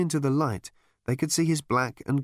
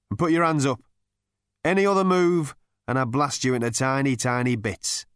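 raised, hectoring bark, easing toward the end; barked threat; 3 semitones higher, 4 dB louder than usual. A narrator's reading. Captor threatening intruders with a gun, barking. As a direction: hector them like an armed guard. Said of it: "barked the voice".

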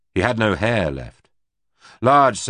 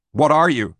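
raised, higher and louder question; 8 semitones higher, 5 dB louder than usual. A narrator's reading. Rick confronts the alien, voice raised. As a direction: demand it, voice raised. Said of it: "his voice raised".

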